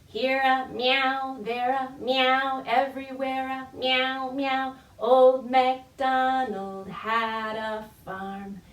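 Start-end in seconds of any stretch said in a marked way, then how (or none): none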